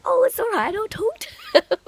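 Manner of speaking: very high, loud mimicking voice; mock reassurance. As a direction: mimic him brushing it off, squeaky and amused. Mimicking his dismissive reassurance.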